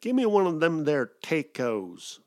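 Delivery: loud, slow Texan drawl; Texan cowboy. Retold or imitated. A big cowboy in line ordering in a Texan drawl. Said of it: "he's like".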